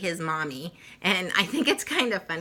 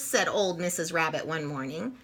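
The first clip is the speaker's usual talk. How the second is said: lively read-aloud for little ones; storybook narration; 3 semitones higher than usual. A narrator's reading. Reading Peter Rabbit to children, animated storytelling. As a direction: tell it like a bedtime picture book.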